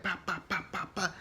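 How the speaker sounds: high "pa pa pa" shot noises; mock gunshot sound effect; imitated bullet hits. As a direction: pop out each bullet hit, high-pitched. Imitating bullets hitting Mia.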